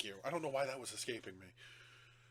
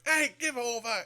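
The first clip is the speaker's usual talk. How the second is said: mock telling off; 9 semitones higher, 8 dB louder than usual. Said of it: "the constable's like".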